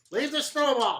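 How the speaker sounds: high little driver ordering; chirpy gnome telling them off. Acted. GM voices driver.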